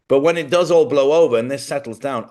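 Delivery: ordinary delivery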